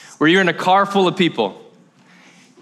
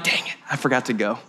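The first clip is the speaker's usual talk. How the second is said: muttered realization; 8 semitones lower, 7 dB quieter than usual. Acted. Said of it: "you're like".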